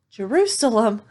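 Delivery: excited. Acted. Little donkey crying out the city's name, thrilled. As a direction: shout the name with sudden joy.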